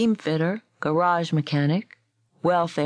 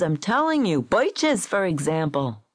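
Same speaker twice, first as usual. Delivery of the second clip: higher, emphatic arguing; insistent protest; 6 semitones higher than usual. A narrator's reading. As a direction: insist on it, pitch jumping up. Gold insisting he heard Frost.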